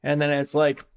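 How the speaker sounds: normal talking voice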